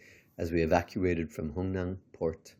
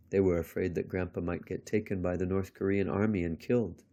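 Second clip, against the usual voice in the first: ordinary delivery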